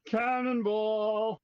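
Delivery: cannonball yell. Pretend. Yelled as laptop plug swap starts.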